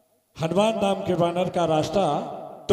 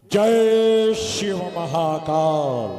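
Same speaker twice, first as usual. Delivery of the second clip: long drawn-out ritual cry; booming invocation; 3 dB louder, much slower than usual. Acted. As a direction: stretch each word into a stage king's salute. King's parting invocation. (खींचकर गूंजता हुआ जयकारा)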